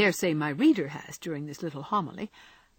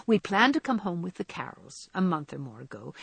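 plain reading voice

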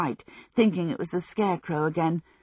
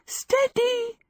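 very high, giddy cry of alarm; high gasped warning. A narrator's reading. Sophie tries to hold the knife clear, high giddy gasp. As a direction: gasp it higher, thrown off balance. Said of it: "she gasped".

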